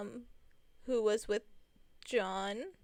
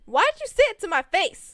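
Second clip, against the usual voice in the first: a much louder, higher indignant cry; exasperated outburst; 8 semitones higher, 12 dB louder than usual. Real, not acted. Asking Trent why he said it, loud exasperated outburst. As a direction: let the hurt burst out. Confronting the old insult.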